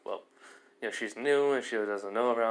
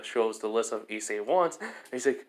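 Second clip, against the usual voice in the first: normal talking voice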